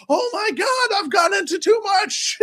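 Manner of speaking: high, loud panicked cry; mock panic. Voicing Mickey Mouse as the water leaks, mock panic.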